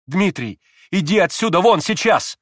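urgent, imperious shout; commanding cry. A narrator's reading. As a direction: order a brother out with authority. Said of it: "властно вскрикнул Алёша".

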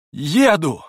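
high, loud decisive cry; sudden exclamation. A narrator's reading. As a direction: exclaim it all at once. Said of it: "воскликнула она вдруг".

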